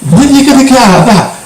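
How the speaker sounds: high-pitched exasperated nagging; mock telling-off of a parent; retold scolding. Retold scolding of mum over game.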